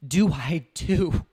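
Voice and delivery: high, pleading mock apology; hammed-up relative begging forgiveness; mock panicked relative. Relative at Christmas apologizing for mocking crypto, pleading. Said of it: "they're gonna be like".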